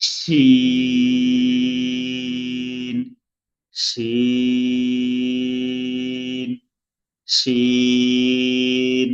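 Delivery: শিন held long with a hiss; drawn-out shin. Shown as a demonstration. Teacher drills Arabic letter, long hissed শিন. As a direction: stretch শিন slowly, hissing. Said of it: "তিন আলিফ টানতে হবে".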